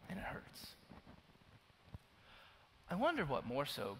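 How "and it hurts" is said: a hushed, breathy confession; whispered. Real, not acted. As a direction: let it fall to a whisper. Confessing pain after a pause.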